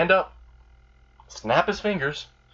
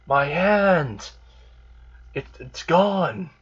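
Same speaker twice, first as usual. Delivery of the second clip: high, stretched-out mock wail; hammed-up pain without real volume; mock pained cry; 6 semitones higher, much slower than usual. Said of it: "he's, like, screaming in pain".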